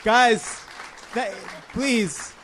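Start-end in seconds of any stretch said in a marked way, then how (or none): none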